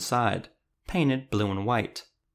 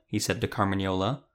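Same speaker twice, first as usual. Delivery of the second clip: ordinary delivery, nothing marked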